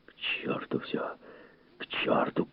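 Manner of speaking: whispered, voiceless swearing; soft hissed oath; muttered curse. A narrator's reading. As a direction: curse it all under your breath. Montag fed up, swearing.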